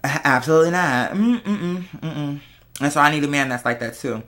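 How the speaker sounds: ordinary talk, nothing marked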